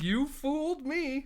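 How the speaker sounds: high, slow dopey voice; high mock fooled. The fooled guard whining after the trick play. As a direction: stretch it out, dopey and impressed.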